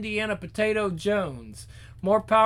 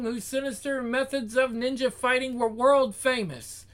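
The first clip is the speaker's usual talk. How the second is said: ordinary delivery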